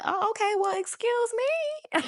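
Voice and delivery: high, drawn-out mock indignation; hammed-up affront with a long, high finish; mock offended. Reacting to being told "please don't contact me", mock offended.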